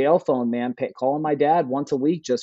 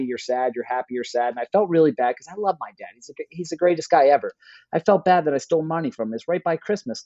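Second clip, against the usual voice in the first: ordinary talk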